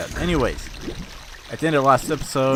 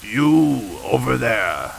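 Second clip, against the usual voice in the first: villain voice; much slower than usual. Acted. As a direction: growl like a game villain. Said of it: "I get to use this voice".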